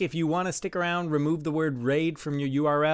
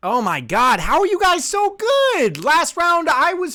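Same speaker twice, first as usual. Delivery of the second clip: loud, much higher, exasperated; exasperated yell; 10 semitones higher, 5 dB louder, slower than usual. Real, not acted. Streamer yelling at rivals for being so good, exasperated. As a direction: cry out in disbelief.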